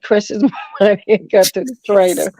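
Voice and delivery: breaking into laughter mid-sentence; laughing. Real, not acted. Caller joking to host about her taking the money, laughing. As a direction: say it through a bubbling laugh. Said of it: "that's not funny".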